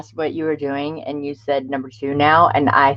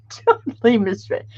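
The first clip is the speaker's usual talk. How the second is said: laughing; 6 semitones higher than usual. Real, not acted. Laughing at her own mistake.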